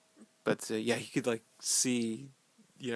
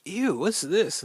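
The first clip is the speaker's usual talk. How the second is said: higher, louder, slow grossed-out "ew"; mock disgust; 8 semitones higher, 7 dB louder, slower than usual. Marine touching goo on the door, mock disgusted. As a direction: pull back from the slime in mock disgust. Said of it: "and they're like".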